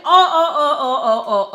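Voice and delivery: loud volley of short ohs; loud repeated ohs. Retold or imitated. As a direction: fire off ohs in protest. Acted outburst in quarrel.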